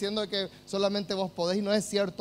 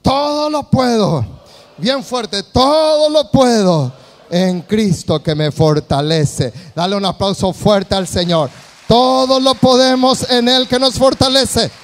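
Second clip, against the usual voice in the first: preaching at full force; 14 dB louder than usual. Acted.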